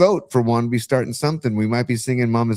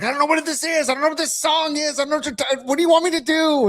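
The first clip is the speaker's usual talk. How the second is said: much higher, hammed-up exasperated whine; mock pissed-off anchor rant; mock angry O'Reilly; faster than usual. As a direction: squeal it like a furious anchor losing it. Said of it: "He's like / he's so pissed off".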